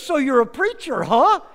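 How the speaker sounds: high, loud, prodding question; imitated old man, loud and high; nosy old stranger. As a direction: pipe up loudly, ending on a punchy huh. Old man quizzing Craddock.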